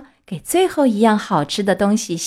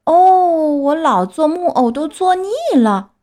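fed-up cry; 7 semitones higher, 4 dB louder than usual. A narrator's reading. Puppet protests to fairy about staying wooden, fed-up cry. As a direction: wail in exasperation, stretching the opening "oh". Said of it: "匹诺乔拍着后脑勺大叫着说".

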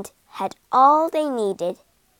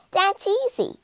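rat's voice; 8 semitones higher than usual. A narrator's reading. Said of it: "she said".

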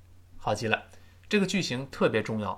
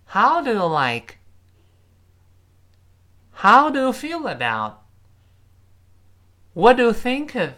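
louder and higher each time, a teacher's drill; model reading; 9 semitones higher, 7 dB louder than usual. Shown as a demonstration. Teacher drilling three phrases loudly with the class. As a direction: call the three phrases out for pupils to repeat. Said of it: "再来一遍".

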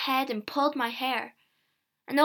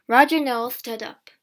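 ordinary delivery, nothing marked

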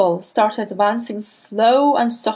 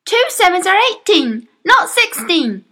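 put-on much higher, louder child, slowing on not sixteen; frightened boy; 11 semitones higher, 5 dB louder than usual. A narrator's reading. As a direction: insist in a panic. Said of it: "he cried".

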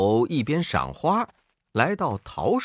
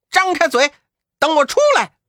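much higher and louder shout; calling out; 12 semitones higher, 7 dB louder, faster than usual. A narrator's reading. The monkey shouts up from inside the demon's belly, loud and high. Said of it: "悟空在里面叫道".

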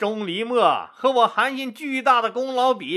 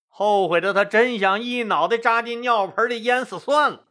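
ordinary delivery, nothing marked

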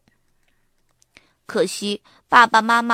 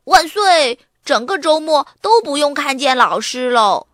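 higher, louder cheer of relief; happy shout; 5 semitones higher, 6 dB louder than usual. A narrator's reading. Arthur cheering at home that the weekend is teacher-free, happy shout. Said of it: "他开心地喊着".

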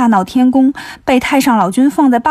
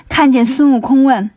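usual speaking voice

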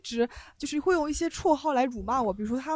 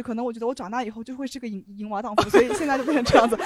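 ordinary talk, nothing marked

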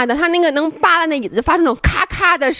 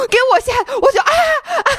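frightened cry; 12 semitones higher than usual. Retold or imitated.

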